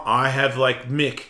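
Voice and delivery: ordinary talk, nothing marked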